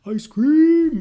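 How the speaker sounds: wife's thought as a loud happy outburst; excited exclamation. Pretend. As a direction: blurt it out like a kid spotting dessert. Wife's imagined thought about ice cream.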